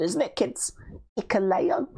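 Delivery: put-on high, sing-song kids' host; kids' presenter voice. To the kids watching, a sing-song children's host. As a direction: sound out the name for the little ones.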